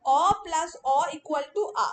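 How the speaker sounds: "অ plus অ equal to আ" said slowly and firmly, stressing "অ"; slow rule drill. Shown as a demonstration. Teacher drills the sandhi rule for memorising, slow and firm. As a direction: dictate the rule so they can memorise it. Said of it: "মনে থাকবে তো?".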